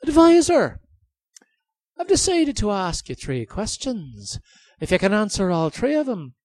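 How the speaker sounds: high, loud, gloating king; cruel king voice. Acted. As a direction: taunt him like a cruel king.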